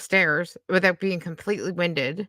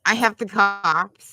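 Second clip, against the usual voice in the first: mocking; 3 semitones higher, 5 dB louder, slower than usual. Mocking the streamer's boast.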